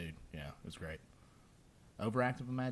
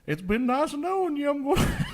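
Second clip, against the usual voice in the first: voicing the cat Brutus; 6 dB louder than usual. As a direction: pretend to be the cat on the phone. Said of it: "I was just like".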